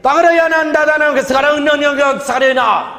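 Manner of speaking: high, loud fake Japanese gibberish; mock Japanese. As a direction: ham up a badly dubbed anime line. Mock Japanese dub opening.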